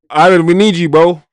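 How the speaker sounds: loud, high call to Ivan. Real, not acted. Streamer calls loudly to viewer Ivan for jokes. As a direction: shout out to a friend in chat.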